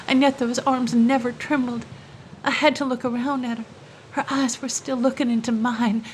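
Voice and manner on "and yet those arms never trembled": ordinary delivery, nothing marked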